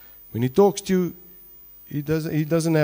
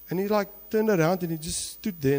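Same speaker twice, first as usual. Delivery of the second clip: usual speaking voice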